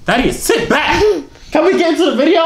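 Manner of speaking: high scolding order; sharp scolding. Real, not acted. Older brother telling Thaddeus to sit, sharp scolding.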